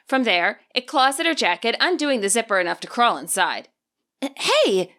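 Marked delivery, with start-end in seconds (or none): 4.4-4.9 startled objection. Acted. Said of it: "Penny objects".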